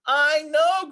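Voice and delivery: put-on high, loud kid voice; impatient little frog insisting; excited kid's I know; child crying out. A narrator's reading. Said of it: "cried Froggy".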